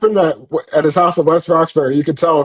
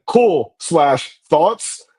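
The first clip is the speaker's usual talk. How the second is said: drawn-out quote; much slower than usual. Retold or imitated. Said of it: "Higgins said".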